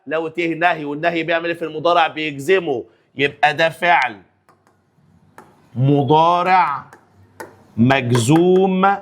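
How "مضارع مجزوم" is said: both words drawn out very slowly; slow drilled parsing. Shown as a demonstration. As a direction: dictate them word by word. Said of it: "teacher drills the parsing".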